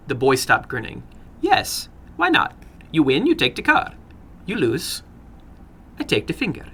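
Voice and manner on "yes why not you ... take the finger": put-on sly older gentleman with an accent; probing the boy, a touch sly; foreign little man. Narrator voices the little man.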